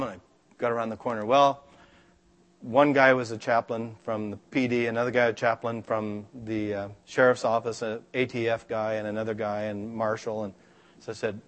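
ordinary delivery

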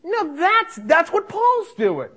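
high, excited exclamation; real delighted enthusiasm. Real, not acted. Preacher delighted by his friend's tract, excited.

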